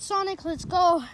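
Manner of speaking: normal talking voice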